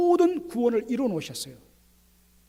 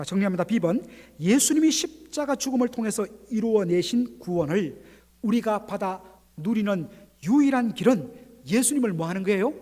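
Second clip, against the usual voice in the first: usual speaking voice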